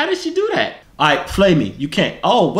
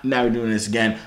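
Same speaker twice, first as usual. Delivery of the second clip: ordinary talk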